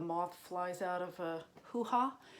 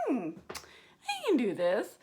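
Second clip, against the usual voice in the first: slow, louder smug musing; drawn-out self-assured hmm; mock waitress; 4 semitones higher, 7 dB louder, slower than usual. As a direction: ham up the overconfident waitress. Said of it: "you're like".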